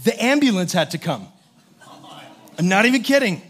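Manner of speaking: usual speaking voice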